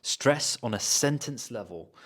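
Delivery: normal talking voice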